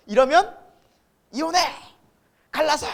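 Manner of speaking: performed angry ultimatum; mock marital shouting. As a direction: act out snapping in a heated row. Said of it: "소리 지르고 싸우다가".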